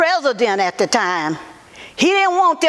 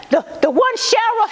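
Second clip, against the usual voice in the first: ordinary talk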